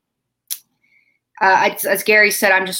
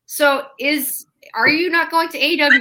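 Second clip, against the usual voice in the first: high-pitched, baffled questioning; puzzled, pitched-up demand for clarification; retold incredulous question; 7 semitones higher than usual. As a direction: act out confused disbelief, voice raised in pitch. Retells her confused reply to brother.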